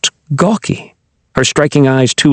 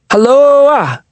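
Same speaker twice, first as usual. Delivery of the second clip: shout; 13 semitones higher than usual. A narrator's reading. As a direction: call out to make it echo. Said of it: "he shouted suddenly".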